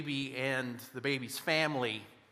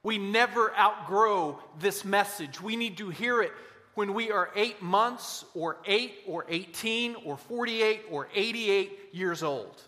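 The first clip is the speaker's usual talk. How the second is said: preaching; 7 semitones higher, 6 dB louder than usual. Acted. Preaching crescendo.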